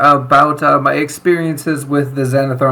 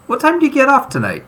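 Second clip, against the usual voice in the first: flirty high pickup line; 11 semitones higher, faster than usual. Acted. Cleric flirts with the guard.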